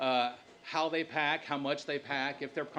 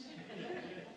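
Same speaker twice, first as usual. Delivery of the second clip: breathy high laugh; 11 semitones higher, 13 dB quieter than usual. Real, not acted. Self-deprecating joke.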